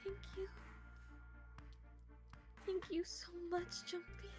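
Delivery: high, tearful, trembling thanks. Acted. June thanking Junpei after sobbing, high trembling voice. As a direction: thank him shakily through tears. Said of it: "Her trembling voice pulled at".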